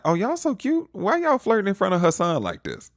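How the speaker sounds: high, loud teasing coo; playful gushing.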